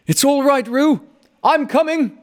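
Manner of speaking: high, loud call across the stream. A narrator's reading. Christopher Robin calls out to Roo while running, high and loud. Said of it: "called Christopher Robin".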